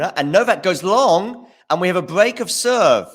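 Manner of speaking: loud, high, thrilled call of the break; excited commentary. Real, not acted. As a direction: shout out the break of serve. Decisive break of serve.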